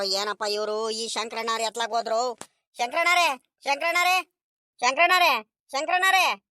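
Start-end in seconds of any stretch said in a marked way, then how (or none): 2.8-6.4 high, insistent hailing, name repeated; calling out. Acted. Villager summoning an elder to the meeting, calling out. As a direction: holler the name to summon him. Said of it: "ಹಿಂಗ್ ಕೂಕಣ್ತಿದೀಯೋ".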